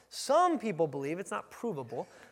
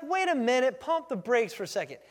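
high, loud disbelieving questions; acted scoffing disbelief, pitched right up; incredulous townspeople; 9 semitones higher, 6 dB louder than usual. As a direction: question it as a scandalised local. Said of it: "They're like".

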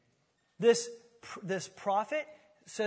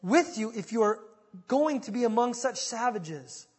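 loud, raised-pitch desperate warning; desperate cry; 4 semitones higher, 12 dB louder than usual. A narrator's reading. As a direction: cry out a warning at full force. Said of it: "Finally, crying in desperation".

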